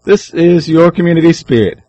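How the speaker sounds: loud dramatic radio intro; booming announcer. Acted. Radio show intro announced loudly.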